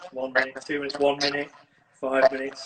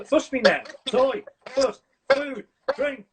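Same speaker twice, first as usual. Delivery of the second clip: higher and higher, a mock demanding dog; greedy dog demands; 10 semitones higher, 3 dB louder, slower than usual. Owner voicing a spoiled dog's demands, mock pleading. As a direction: list each want like a spoiled pet.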